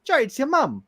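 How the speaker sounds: high, incredulous mum voice; mum on the phone. Retold or imitated. Mum teasing her son about his phone voice, high and incredulous. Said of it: "it's like".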